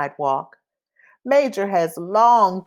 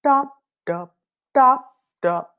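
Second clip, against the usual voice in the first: slow shoe steps; 6 semitones higher than usual. Shown as a demonstration. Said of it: "his shoes go".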